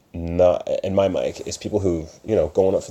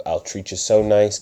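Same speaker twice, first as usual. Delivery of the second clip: normal talking voice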